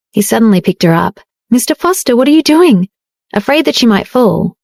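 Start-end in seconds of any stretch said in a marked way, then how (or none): 1.5-2.9 higher, surprised cry as she's lifted; startled. Acted. Jade startled when her husband picks her up, higher surprised voice. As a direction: caught off guard, pitch jumps up. Suddenly picked up by Dario.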